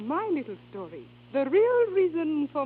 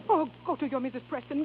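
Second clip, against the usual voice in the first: ordinary delivery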